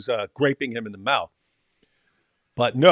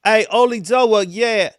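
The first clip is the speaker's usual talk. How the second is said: put-on higher, louder British street accent with bruv; 6 semitones higher, 4 dB louder than usual. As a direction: ham up a cheeky British lad. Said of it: "He's more trash".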